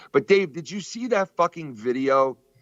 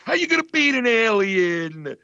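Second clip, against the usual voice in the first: imitating Dice; 9 semitones higher than usual. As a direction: drag out the punchline in disbelief. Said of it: "then he's like".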